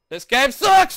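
high, loud burst of annoyance; frustrated yell. Real, not acted. Player giving up on a level, frustrated shout. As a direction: shout it, fed up.

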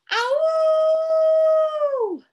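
long, high, loud howl; wolf howl. Pretend. Reader plays wolf for the children, howling. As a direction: throw back a sustained wolf cry. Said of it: "Howl like a wolf".

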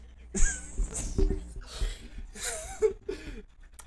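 high, mostly voiceless laugh; laughing at your own nonsense; laughing fit. Real, not acted. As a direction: crack up mid-sentence. Cracks up at own rambling.

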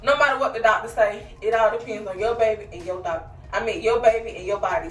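usual speaking voice

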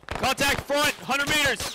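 high, loud yell in combat; battle shout. Real, not acted. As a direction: scream out the contact call. Enemy contact in firefight.

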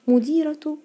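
"مديرة" as mudīratu, loud and high; taa in connected form. Shown as a demonstration. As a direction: sound the ة of "مديرة" as "tu". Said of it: "نطقتها تاء يا مس".

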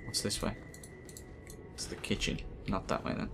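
soft unvoiced whisper. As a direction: whisper like sneaking around. Sneaking through the mansion.

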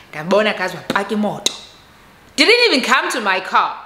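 usual speaking voice

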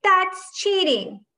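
high, loud, drawn-out shout; stretched high yell of protest; shouted accusation. A narrator's reading. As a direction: call out the cheat, outraged. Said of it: "shouted Akemi".

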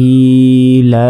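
ইলা chanted very slowly; ইলা recited long and sung; drawn-out recitation. Shown as a demonstration. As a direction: hold ইলা in a slow chant. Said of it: "এখানেও এক আলিফ".

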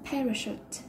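"parachute" with the first syllable PA hit; teacher models stress on PA in "parachute". Shown as a demonstration. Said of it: "trọng âm rơi vào âm đầu tiên".

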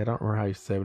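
ordinary delivery

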